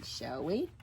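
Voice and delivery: plain reading voice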